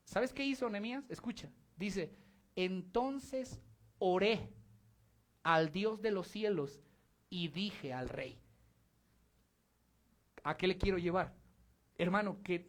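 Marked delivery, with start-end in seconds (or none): 2.6-8.4 slow solemn reading. Acted. Scripture read slowly, solemn.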